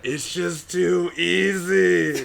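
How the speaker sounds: high, loud, drawn-out Southern drawl; exaggerated Southern accent. Friend caught faking it late at night, hammed-up Southern accent. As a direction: belt it out slowly in a hammed-up Southern twang. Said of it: "have we been talking in Southern accents".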